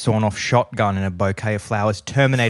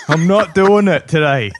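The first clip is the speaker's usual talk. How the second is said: louder, higher flat-out refusal; comic exasperation, digging in; fed up; 6 semitones higher, 6 dB louder than usual. Acted. Hero refusing the day's tragic news, fed up. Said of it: "fed up with the responsibility".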